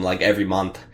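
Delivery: ordinary talk, nothing marked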